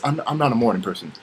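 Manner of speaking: ordinary delivery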